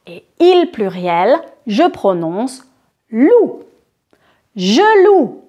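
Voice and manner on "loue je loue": "Je loue" said as "lou", the final E kept silent; teacher models "Je loue" ending clean on the OU sound. Shown as a demonstration. Teacher shows the class "Je loue" with a silent E. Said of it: "Je ne prononce pas le E".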